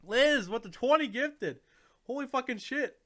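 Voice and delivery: high, loud, overjoyed yelling; real astonished excitement. Real, not acted. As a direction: shout in amazed delight at a big gift. Reacting to twenty gifted subs.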